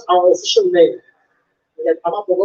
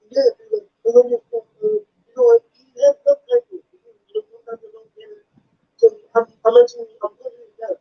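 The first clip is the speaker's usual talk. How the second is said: much higher, slurred imitation of a deaf fan's speech; imitated deaf fan; 14 semitones higher than usual. Fan praising the comedian's stuff, high slurred imitation. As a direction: gush like an eager fan who talks fast. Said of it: "he was partially deaf".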